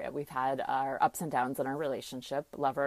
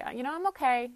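lightly voiced mother, higher and hesitant; a hint of mom, pitched up and reluctant; imitating mother; 11 semitones higher, 3 dB louder than usual. Daughter voicing her mother's reluctant reply in a higher voice.

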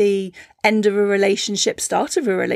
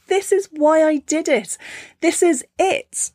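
proud flower; 7 semitones higher than usual. Acted. Flower in full bloom, high and triumphant. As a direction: savour the moment of blooming.

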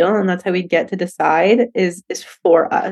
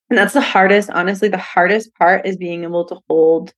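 usual speaking voice

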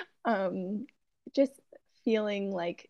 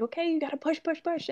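retold panic, pitched high; panicked paramedic; 8 semitones higher, faster than usual. Paramedic urging a mother in labor to push, imitated panic. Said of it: "hear the panic in his voice".

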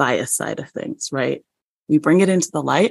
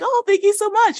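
gushing thanks. Retold or imitated. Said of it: "And I was like".

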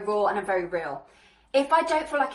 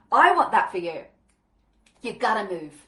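ordinary talk, nothing marked

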